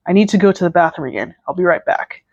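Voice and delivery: plain reading voice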